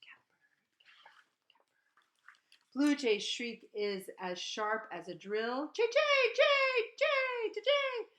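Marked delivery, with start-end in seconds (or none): 0.0-2.7 near-silent breathy catbird; whispered call. Retold or imitated. Pointing at the nest, whispering the catbird call. 5.7-8.1 very high, loud shrieking; jay shriek. Retold or imitated. Reading the blue jay's sharp call, very high and loud.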